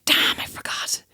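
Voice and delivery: hushed mock curse to oneself; acted aside, softly whispered; whispered realisation. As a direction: whisper it, caught out by your own memory. Sudden remembering of the grudge.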